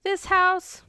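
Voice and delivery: high, lightly voiced boy; a hint of a little boy; lightly voiced kid; quoting a child. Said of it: "And Jackson says".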